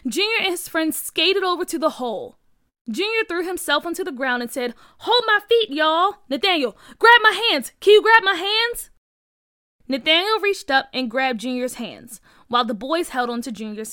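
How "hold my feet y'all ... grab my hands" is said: high, loud rescue commands; raised, pressing yell; urgent orders. Acted. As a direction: shout instructions to save a friend. Rescuer shouts orders at the hole.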